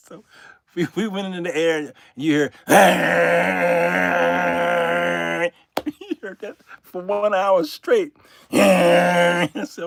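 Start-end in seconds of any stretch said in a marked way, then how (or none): 2.8-5.5 long crying shout of engine noise; imitated engine roar. Mimicking the loud engine for an hour, wailing roar. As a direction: howl like the straining plane.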